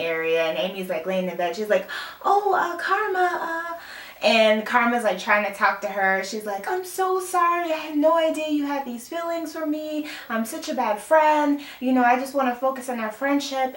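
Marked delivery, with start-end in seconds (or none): 2.2-3.7 imitating a caught-out friend, high-pitched; flustered greeting. 6.7-13.7 imitating an apologetic friend, high-pitched; mock pleading apology.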